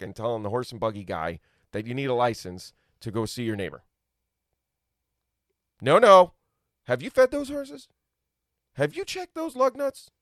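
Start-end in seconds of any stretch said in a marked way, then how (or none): 5.8-10.1 high-pitched mock official questioning; pitched way up, slow prissy inspection; nagging busybody. Busybody checking the buggy guy's horses, high mock-nagging voice. Mimics a nagging licence enforcer.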